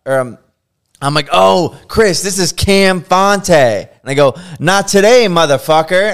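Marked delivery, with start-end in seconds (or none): 1.3-3.5 higher, louder, slower excited call-out; excited announcement. Retold or imitated. Artist telling his boss who is calling, excited. Reading caller name aloud excitedly. 4.6-6.1 higher and louder defiant yell; cocky shout. Retold or imitated. Artist yelling at the drunk client's call, cocky shout.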